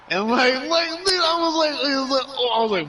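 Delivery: can't believe what he's retelling; stunned excitement. Real, not acted. Retelling his Marine buddy's wild line, squealing in disbelief. Story climax, speaker in disbelief.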